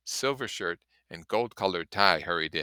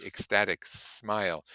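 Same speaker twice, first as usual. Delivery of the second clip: usual speaking voice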